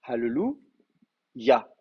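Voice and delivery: Hallelujah very slowly with a breathed h and a held double l; Hebrew pronunciation. Shown as a demonstration. Teacher contrasting the Hebrew word with the French Alléluia, very slow. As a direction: draw out Hallelujah in the Hebrew way, hal-lu-ya. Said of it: "quand vous dites Alléluia".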